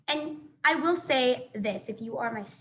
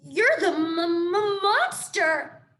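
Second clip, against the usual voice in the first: frightened stammer; 6 semitones higher, 5 dB louder, much slower than usual. Acted. Opossum terrified, stutters at robot.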